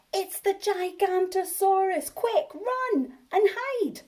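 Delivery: put-on young dinosaur crying wolf to his friends, high and panicky; alarmed cry. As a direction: cry out the alarm, drawing out run and hide. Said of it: "Bonehead cried".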